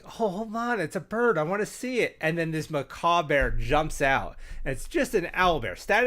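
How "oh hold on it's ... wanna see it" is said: excited druid. Retold or imitated. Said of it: "the druid's like".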